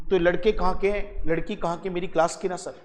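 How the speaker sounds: ordinary talk, nothing marked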